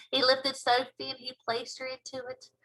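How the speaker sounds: plain reading voice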